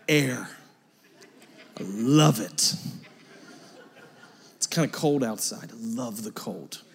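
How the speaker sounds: mock-serene, low and soft contented sigh; blissful morning person. Preacher mimicking people who wake up smiling, mock-blissful. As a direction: play a dreamy, too-content early riser, low and hushed. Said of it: "they're just walking through and they're like".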